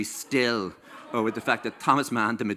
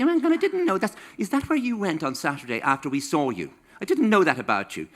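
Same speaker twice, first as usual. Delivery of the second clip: much higher, gossipy gasp of discovery; mock surprise; 7 semitones higher than usual. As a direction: play the nosy reader shocked by the diary. Mock snoop finding diary secrets.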